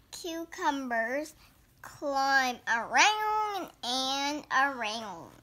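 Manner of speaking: slow sing-song storybook read-aloud; picture book reading. Acted. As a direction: read each page like a picture book for little kids.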